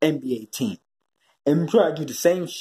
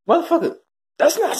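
exasperated outburst; 10 semitones higher than usual. Real, not acted.

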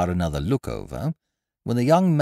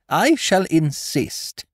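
slow, much higher, louder insistence; firm insistence; 10 semitones higher, 7 dB louder, much slower than usual. A narrator's reading. Headmistress overruling modesty, in a slow, high, loud voice. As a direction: lay down the law slowly.

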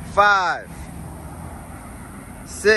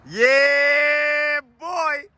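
excited shout; 4 semitones higher than usual. Real, not acted. Hyping up a rep.

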